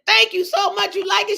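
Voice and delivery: high, bright-pitched excited thanks; delighted thanks. Retold or imitated. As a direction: thank her with a thrilled lift. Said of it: "I said".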